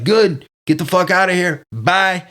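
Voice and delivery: imitating counselor snapping, pitch up; hammed-up annoyed boss; mock angry dismissal. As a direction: throw the kid out. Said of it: "and he's like".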